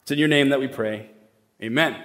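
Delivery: low, measured prayer voice. Real, not acted. Pastor asking God to open hearts this morning, prayerful. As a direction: pray it gently, pitch dropping at the phrase ends.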